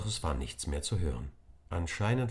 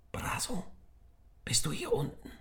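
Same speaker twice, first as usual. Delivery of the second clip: hushed whisper into the dark; 12 semitones higher, 3 dB quieter than usual. Acted. Nick searches the dark cellar for his friend, whispering. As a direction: whisper so nobody hears. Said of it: "flüsterte Nick alle paar Meter".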